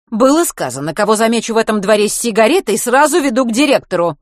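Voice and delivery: put-on strict vice-principal catching boys outside; stern teacher. A narrator's reading. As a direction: interrogate like a strict head teacher.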